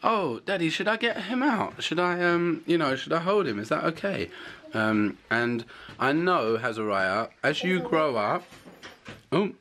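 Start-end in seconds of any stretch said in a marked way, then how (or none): 0.0-4.3 put-on higher, sweet little girl voice; imitating his daughter. Dad imitating his daughter offering help, lightly higher. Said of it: "she suggests".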